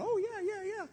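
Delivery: high, eager mock agreement; eager enthusiast. Enthusiasts in the room eagerly agreeing, high and excited. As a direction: play the keen believer nodding along. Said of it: "the enthusiasts among us are going".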